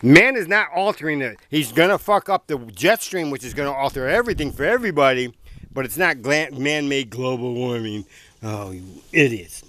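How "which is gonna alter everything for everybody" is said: normal talking voice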